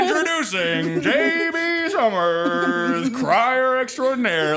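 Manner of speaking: slow booming ring announcer; drawn-out, booming, like a wrestling intro; booming wrestler boasts. Pretend. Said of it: "that's my new way of, like, introducing myself".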